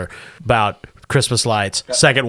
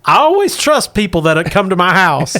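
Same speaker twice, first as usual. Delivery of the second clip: trusting housewife, pitched up; Gamorrean woman; 5 semitones higher, 3 dB louder than usual. Acted. Woman letting strangers into her house, high character voice. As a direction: welcome them in with a raised character voice. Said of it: "A middle-aged Gamorrean woman".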